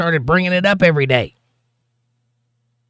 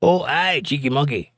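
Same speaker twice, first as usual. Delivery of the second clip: put-on Scottish accent. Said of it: "Nope, that's, uh, late night T V".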